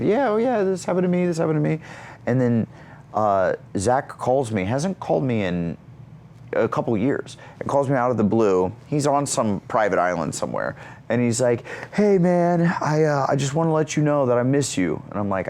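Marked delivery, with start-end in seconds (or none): 0.0-1.8 high-pitched mock group chatter; excited chiming in. Acts out excited group texts. 11.9-15.0 higher-pitched imitated friend on phone; lightly voiced heartfelt caller; Zac Efron.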